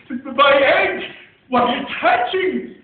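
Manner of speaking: stuttered b- sounds bursting into a loud cry; stammering shout. Acted. Horton startled by his hatching egg, stammering shout. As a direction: splutter then shout it out. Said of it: "shouted Horton".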